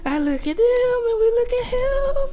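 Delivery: very high, stretched-out storytelling voice; sustained, sing-song high voice; falsetto suspense. Acted. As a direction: draw each look out in high falsetto. Suspenseful exchange of looks, put-on voice.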